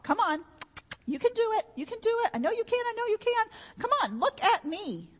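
high, eager cheering up the ladder; encouraging call. Retold or imitated. God calling down to someone climbing, high eager encouragement. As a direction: cheer them on like a coach at the top.

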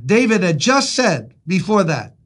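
usual speaking voice

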